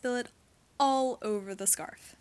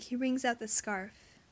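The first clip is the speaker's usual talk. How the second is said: ordinary delivery, nothing marked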